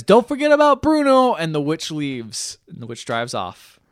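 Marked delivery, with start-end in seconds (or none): 0.0-1.3 put-on much higher, squeaky kid voice; boy turned back from mouse calls to grandma, high and excited. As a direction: burst out with childish delight. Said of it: "he says".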